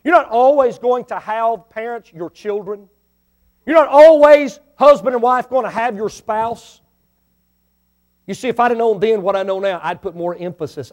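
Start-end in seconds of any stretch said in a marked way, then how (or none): 3.7-6.8 loud, high, pleading cry; preaching shout. Real, not acted. Preacher warning husbands and wives, loud and high.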